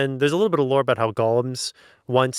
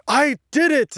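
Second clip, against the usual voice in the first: much higher mock triumph; triumphant; 13 semitones higher, 3 dB louder than usual. Nathaniel waking up, mock triumphant. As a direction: crow a hammed-up victory. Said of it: "Nathaniel wakes up and is like".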